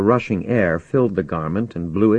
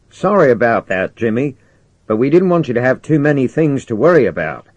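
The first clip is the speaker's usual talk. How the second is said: raised, louder commander's voice; apologetic commander; 5 semitones higher, 6 dB louder than usual. Acted. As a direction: explain it to Jimmy over the radio.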